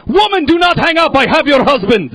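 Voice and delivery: high, loud hammed-up kidnapper bark; shouted kidnapper threat. Kidnapper shouting at the wife on the phone. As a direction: yell it like a furious captor. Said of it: "This is what he says verbatim".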